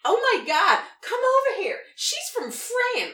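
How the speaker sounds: high and loud, thrilled cashier; excited cashier. Retold or imitated. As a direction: squeal it like she's spotted a celebrity.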